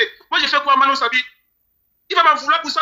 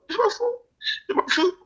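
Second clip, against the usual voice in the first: cracking despair; 8 semitones higher, 5 dB quieter than usual. Real, not acted.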